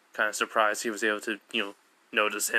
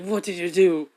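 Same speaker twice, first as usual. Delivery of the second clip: higher, louder demanding question; accusing disbelief; shocked, higher and louder; 6 semitones higher, 4 dB louder than usual. Retold or imitated. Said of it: "Mineta goes like".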